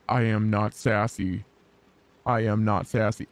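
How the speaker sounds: low, forced deep growl. Shown as a demonstration. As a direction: growl it from the chest. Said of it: "Just growl".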